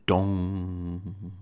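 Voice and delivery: bell toll. Acted. Clock bell strikes.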